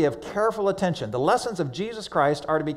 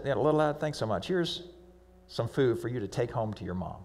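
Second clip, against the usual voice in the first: tender thanks; 5 semitones lower, 9 dB quieter than usual. Acted. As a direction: lower and softer, kind. Jesus thanking the boy.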